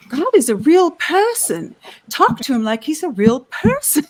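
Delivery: a loose Irish lilt on the quote; Irish accent. Retold or imitated. Quoting Sister Bree in a hammed Irish accent. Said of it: "I'm gonna totally blow this accent".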